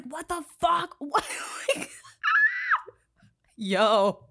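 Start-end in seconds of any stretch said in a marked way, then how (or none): 1.9-2.9 high, loud squeal of disbelief; high-pitched exasperated squeal; incredulous shriek. Real, not acted. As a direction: shriek out a laugh in outrage. Disbelief at the lenient sentence.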